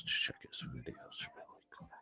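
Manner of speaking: soft whisper to himself, barely there; whispered aside. Real, not acted. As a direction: drop to a breathy murmur as you click around. Aside while checking videos.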